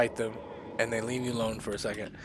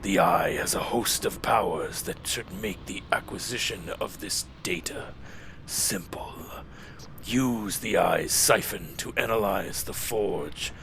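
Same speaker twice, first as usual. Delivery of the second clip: low, slow, whispery Lich King; 3 semitones lower than usual. Acted. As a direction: ancient lich, hushed and slow. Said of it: "I'm trying to get the Lich King voice back".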